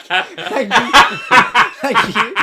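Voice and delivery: sarcastic laughing thanks. Retold or imitated. Insulted by drunk mate about his head, laughing sarcastic thanks. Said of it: "I was like".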